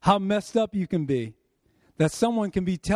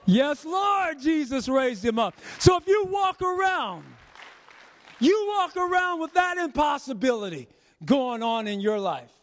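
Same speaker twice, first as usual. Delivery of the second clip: preaching shout; 7 semitones higher than usual. Acted. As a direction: shout it out to the church.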